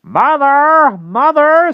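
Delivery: very high, piercing alarm call; screaming crow. A narrator's reading. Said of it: "screamed the crow".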